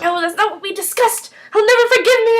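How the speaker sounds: high-pitched mock distress, rising; upset protest, imitated. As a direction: act out his worried protest. Voices a protesting, upset character.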